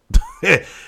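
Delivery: sudden loud burst of laughter. Real, not acted. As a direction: break into a big real laugh. Laughs after giving up on tire.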